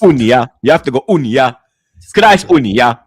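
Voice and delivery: Unia said in a loud West African accent. Shown as a demonstration. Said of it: "you gotta do it in an African accent".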